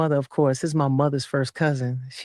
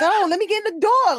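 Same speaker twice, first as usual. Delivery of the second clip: exasperated; 4 dB louder than usual. Retold or imitated. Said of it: "reply to friends".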